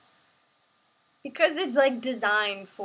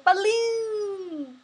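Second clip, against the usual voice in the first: put-on high, loud childlike cry; gleeful shout; 11 semitones higher, 8 dB louder, much slower than usual. Streamer shouting to chat in a high childlike squeal. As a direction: squeal it like a delighted kid. Shouting random joyful words.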